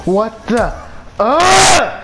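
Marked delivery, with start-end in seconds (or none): 1.2-2.0 high, loud groan of rage; real exasperated shout; frustrated yell. Real, not acted. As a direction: let out an angry ugh. Frustrated outburst.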